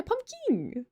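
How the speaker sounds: pumpkin with a high second syllable like king; pronounced as spelled. Shown as a demonstration. Streamer insisting on the name to chat, spelled-out pronunciation. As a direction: insist on pumpkin, pitched right up. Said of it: "pronouncing it the way it's spelled".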